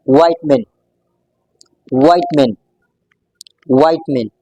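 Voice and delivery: vitamin with a long i, "VITE-min"; American pronunciation. Shown as a demonstration. Teacher repeats the American form slowly, American pronunciation. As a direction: say vitamin starting like "vite", middle swallowed. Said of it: "with a different pronunciation".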